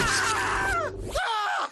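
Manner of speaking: high, loud scream. Acted. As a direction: let out two sharp high screams. Screams amid clashing voices.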